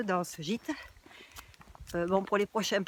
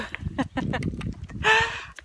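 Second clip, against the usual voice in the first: high airy laugh; breathless laugh; 13 semitones higher than usual. Real, not acted. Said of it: "je suis essoufflée".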